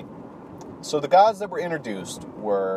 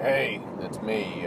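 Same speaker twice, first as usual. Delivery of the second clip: gruff, softer drawn-out greeting; gruff dad; 2 semitones lower, 5 dB quieter, slower than usual. Acted. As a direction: drag it out softly like a gruff older man. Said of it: "he's a bit more gruff".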